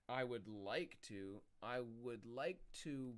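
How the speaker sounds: slow deliberate mock phrasing. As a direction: stretch it out slowly.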